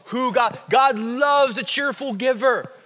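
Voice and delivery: normal talking voice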